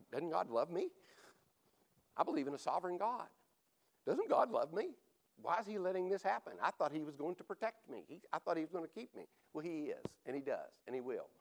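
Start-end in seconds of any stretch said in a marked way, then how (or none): 0.1-9.2 higher, anxious pleading questions; the doubting voice crying why me; doubting inner voice. Retold or imitated. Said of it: "that's again that voice".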